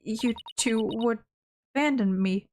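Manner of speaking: ordinary talk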